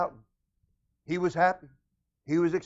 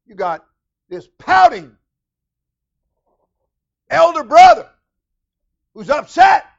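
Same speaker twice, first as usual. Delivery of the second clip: high, loud sermon cry; preaching at full force; 14 semitones higher, 19 dB louder than usual. Acted. Preacher tells congregation the rest of the story. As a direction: lift the church with it.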